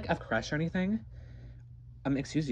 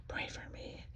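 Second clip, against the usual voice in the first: softer, unvoiced whisper; whispered aside; 16 dB quieter than usual. Nervous aside to viewers, whispered. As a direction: whisper it to the camera.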